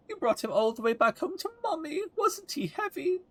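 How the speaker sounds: high quavering granny, fretting over her cat; doting old lady. Acted. Voices Layla thanking.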